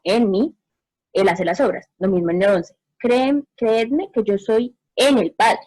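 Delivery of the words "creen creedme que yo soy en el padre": en in "en el Padre" leaned on, slow; slow down and press the word en; stress on en. Shown as a demonstration. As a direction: en said slowly and firmly, to set it against con. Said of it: "no con el Padre".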